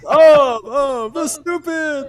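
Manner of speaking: high, slow mock announcer; announcer jingle voice. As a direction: belt it like a news jingle. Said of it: "I automatically Pavlovian have the".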